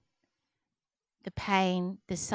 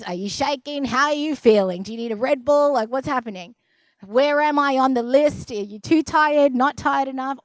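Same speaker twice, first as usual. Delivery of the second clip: rapid string of nagging questions, building louder; pestering checklist, getting more insistent; annoying interrogation; 3 semitones higher, 6 dB louder than usual. Retold or imitated. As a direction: imitate a patient grilling the surgeon. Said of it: "I'm the most annoying".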